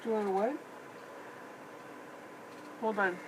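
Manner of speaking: usual speaking voice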